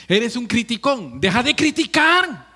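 loud, high, rising scolding; forceful rebuke. Acted. Preacher scolding critics in the congregation, loud high rebuke. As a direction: really come down on the critic.

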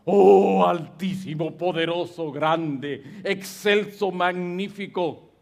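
mock grand prayer, big and loud on the first word; showy prayer voice. Shown as a demonstration. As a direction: ham up a pompous prayer. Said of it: "no está determinado por la entonación que uso". (parodia de oración grandilocuente y sonora)

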